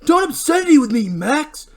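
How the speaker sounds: high furious yell; angry shout. Acted. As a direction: rage at him. Barry threatening Max.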